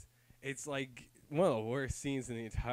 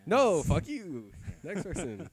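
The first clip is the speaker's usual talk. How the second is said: mock dismissive character; 3 semitones higher, 3 dB louder, slower than usual. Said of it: "was just like".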